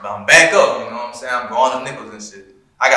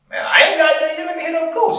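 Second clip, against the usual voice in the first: excited; 14 semitones higher than usual. Retold or imitated. Retold own reply, high pitched.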